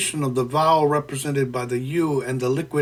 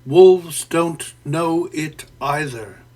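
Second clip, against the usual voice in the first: slow, louder, each word stressed; recited opening line; 5 dB louder, slower than usual. Shown as a demonstration. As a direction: read the line out slowly, weighting every word. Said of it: "Consider the opening".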